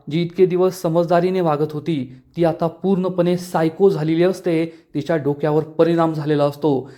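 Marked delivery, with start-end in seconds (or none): none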